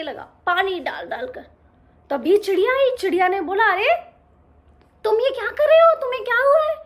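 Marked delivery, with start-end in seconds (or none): 3.6-6.8 high, loud chirpy alarm; a bird rushing in worried; startled concerned bird voice; worried little bird. Acted. Bird alarmed at crying cub.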